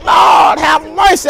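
high, loud church shout; church folk screaming. Retold or imitated. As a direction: holler it like the congregation. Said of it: "they scream".

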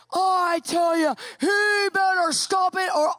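high, loud, slow threatening yell; furious shout. Retold or imitated. Husband stomping to the bathroom, shouting threats. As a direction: bark the threat at full volume.

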